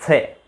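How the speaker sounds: स said as dental sa, tongue tip on the teeth; dental sa demo. Shown as a demonstration. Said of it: "फिर उसका उच्चारण कीजिए". (दंत्य स का उच्चारण)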